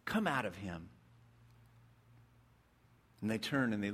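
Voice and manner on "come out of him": low, firm command; understated commanding Jesus; voice of authority. A narrator's reading. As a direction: command the spirit out, lightly stern. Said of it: "commanded with this voice of authority".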